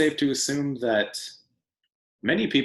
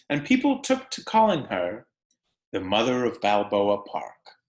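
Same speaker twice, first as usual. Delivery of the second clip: bright storybook read-aloud, pitched up and unhurried; animated picture-book narration; reading to children. Acted. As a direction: read it to a class of kids, lively and clear. Teacher reads picture book aloud.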